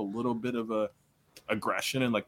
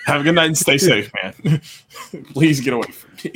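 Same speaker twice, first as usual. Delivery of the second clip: sarcastic sendoff; 2 semitones higher, 8 dB louder than usual. Retold or imitated. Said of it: "just like".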